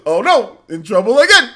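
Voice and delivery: loud, high cartoon bear lament; hammed-up cartoon bear dismay; Yogi Bear. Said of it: "Talking like Yogi Bear".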